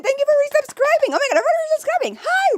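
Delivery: high, fast, gushing thanks; excited thanks. Real, not acted. Streamer thanks resubscribers in a high, fast gush. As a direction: squeal with gratitude.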